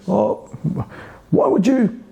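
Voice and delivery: high, surprised "why would you"; surprised question. Shown as a demonstration. Demoing a slow switch, surprised and high. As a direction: rise up in mock surprise. Acted reaction in demo.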